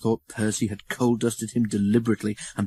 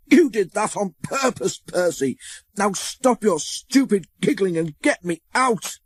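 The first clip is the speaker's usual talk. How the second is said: furious; 9 semitones higher than usual. A narrator's reading. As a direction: shout at him in a rage. Thomas still furious at Percy.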